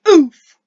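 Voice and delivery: loud punchy "oof" grunt; hard landing oof. Pretend. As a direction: grunt like taking a hard fall. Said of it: "we need to make an oof sound".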